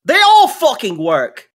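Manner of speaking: high, loud angry camp boss yelling back; gruff NPC shouting. Acted. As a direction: bark it out like an irate old tinkerer. GM voicing NPC.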